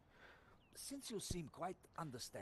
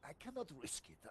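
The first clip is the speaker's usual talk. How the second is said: hammed-up French sergeant accent; a tempted French soldier; comic French accent, torn. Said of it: "you just get a French accent".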